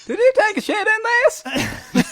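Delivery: squeaky, very high, slow and laughing; crazy cop voice. Retold or imitated. Imitating the crazy cop accusing his wife. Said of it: "he's got the craziest voice".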